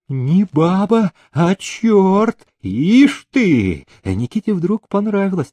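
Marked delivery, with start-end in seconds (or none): 0.1-3.9 high, slow outburst; astonished exclamation. A narrator's reading. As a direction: marvel loudly at her stubbornness. Master exclaims at stubborn maid. (изумлённо, высоко и протяжно)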